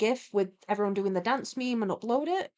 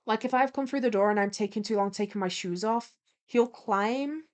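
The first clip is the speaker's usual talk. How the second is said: usual speaking voice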